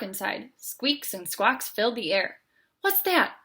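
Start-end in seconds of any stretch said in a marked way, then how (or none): 2.8-3.4 nervous, high and quick; a jumpy little turtle in the dark; startled high question. A narrator's reading. Franklin in the bat cave, startled and high. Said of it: "asked Franklin".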